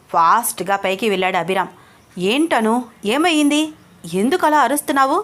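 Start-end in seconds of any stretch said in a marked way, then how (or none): 2.2-5.2 alarmed. Acted. Rushing up after her scream.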